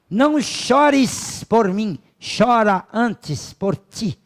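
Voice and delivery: stately, emphatic quoting of a philosopher; declaimed quotation. Retold or imitated. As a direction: proclaim it in long, stretched words. Quoting Socrates's admonition again.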